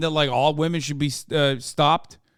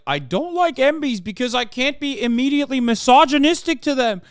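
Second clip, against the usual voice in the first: a high mocking voice; mock Peterson; 7 semitones higher, 4 dB louder than usual. Streamer mocking Jordan Peterson's take, high mock voice. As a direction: mimic the pundit as petty and dim. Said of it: "he's like".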